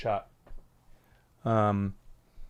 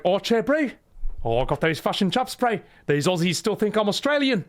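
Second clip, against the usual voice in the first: exaggerated Kiwi accent, lifted pitch; mock New Zealand phone chat; Ocelot as New Zealander; 6 semitones higher than usual. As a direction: put on a cheeky Kiwi voice. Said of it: "he calls up the New Zealand Prime Minister".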